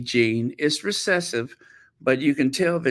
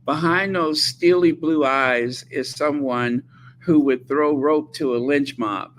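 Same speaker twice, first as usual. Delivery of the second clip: usual speaking voice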